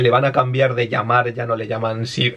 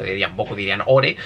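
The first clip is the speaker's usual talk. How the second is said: usual speaking voice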